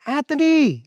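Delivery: high, drawn-out call for a grandson; grandma calling out. Retold or imitated. Nosy grandma summons grandson by name, high-pitched imitated call. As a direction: imitate grandma hollering across the house. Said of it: "my grandma's like".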